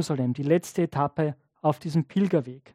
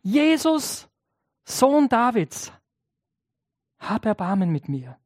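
crying out louder; 3 semitones higher than usual. Retold or imitated. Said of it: "Aber er schreit nur noch lauter".

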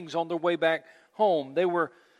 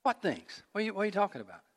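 quiet, sly, teasing question; with a smirk; 6 dB quieter, faster than usual. Acted. Jesus teasing the disciples who do not recognize him, quietly sly. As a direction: let the smirk into it. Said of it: "a little bit of a smirk on his face".

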